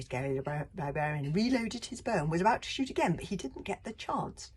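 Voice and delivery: usual speaking voice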